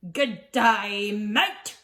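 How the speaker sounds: deliberate, overdone Australian greeting; badly faked Australian accent from a French speaker; attempted Australian accent. French dinosaur showing off his best, clumsy Australian accent. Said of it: "put on your very best Australian accent".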